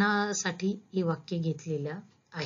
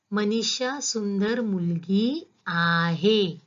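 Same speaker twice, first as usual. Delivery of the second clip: आहे stretched out long and stressed; slow sentence, leaning hard on a drawn-out आहे; much slower than usual. Shown as a demonstration. Teacher showing students the key verb, stress on आहे. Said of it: "मी कुठल्या शब्दावर जोर देते".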